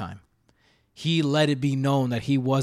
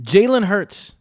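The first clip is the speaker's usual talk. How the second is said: excited announcement; 8 semitones higher, 8 dB louder, much slower than usual. Real, not acted. Announcing the next player on the list.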